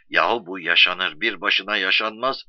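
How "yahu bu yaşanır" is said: ordinary delivery, nothing marked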